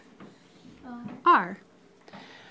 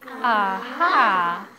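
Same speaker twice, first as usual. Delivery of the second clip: drawn-out, gloating "aha"; put-on "aha!" of someone plotting; bossy aha; 3 dB louder than usual. Shown as a demonstration. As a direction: model it like a bossy schemer. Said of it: "Can you do it like her?".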